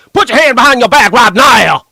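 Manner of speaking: loud, high barking Southern cop. Retold or imitated. Streamer imitating a Southern cop yelling at a suspect. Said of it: "that's how they talk".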